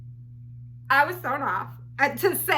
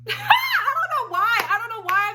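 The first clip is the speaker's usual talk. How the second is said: real laugh; 9 semitones higher than usual. Real, not acted. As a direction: laugh at yourself mid-sentence. Laughs at own question.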